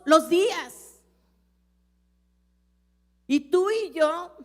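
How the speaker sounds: loud, high, driving sermon delivery; fiery preaching. Acted. Preacher urging the congregation to pray, in full fiery preaching.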